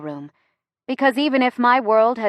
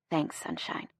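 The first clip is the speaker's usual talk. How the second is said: whisper; 4 semitones lower, 12 dB quieter than usual. A narrator's reading. Quiet thanks under the table.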